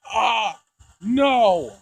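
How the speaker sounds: ordinary talk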